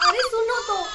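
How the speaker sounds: high, loud tearful cry to be heard; crying shout. Acted. Daughter wails at her scolding mother to be heard, high and loud.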